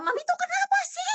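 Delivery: high-pitched re-enacted yell at mother; retold screaming. Daughter yelling at her dying mother at home, high retold scream. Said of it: "saya masih teriak-teriak sama mama saya".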